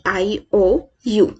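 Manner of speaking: ordinary talk, nothing marked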